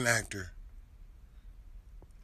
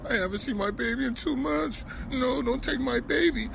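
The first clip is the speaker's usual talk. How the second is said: higher, whimpering mock plea; mock whiny plea; 8 semitones higher than usual. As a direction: mimic the crybaby father, pitched up. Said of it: "You sound like a bitch".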